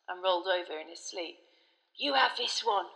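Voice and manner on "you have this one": usual speaking voice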